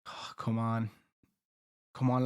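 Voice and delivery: ordinary delivery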